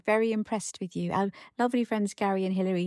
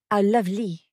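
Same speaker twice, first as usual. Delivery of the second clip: put-on a light French accent on English words; lightly hammed French accent; mock French accent; 4 dB louder than usual. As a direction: imitate him putting on French. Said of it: "You were just doing it with a French accent".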